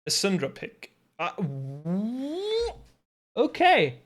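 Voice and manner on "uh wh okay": high, loud startled okay; surprised. Real, not acted. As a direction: caught off guard by the pick, blurts it out. Sudden surprising draft pick.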